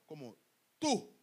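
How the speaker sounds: usual speaking voice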